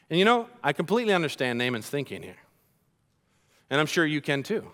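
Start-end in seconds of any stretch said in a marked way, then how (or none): none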